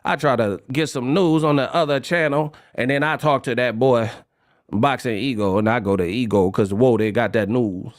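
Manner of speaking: mock New Orleans talk. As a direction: imitate the locals, low and lazy. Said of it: "they be like".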